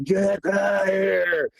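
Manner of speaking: long, smeared mock shout; stretched-out roar imitation, volume held back; imitated creature yell. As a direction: drag the words into one held holler. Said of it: "and was yelling again".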